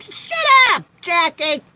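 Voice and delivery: high, loud mock snap at Jackie; mock yell. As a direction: yell it high in fake anger. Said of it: "I was like".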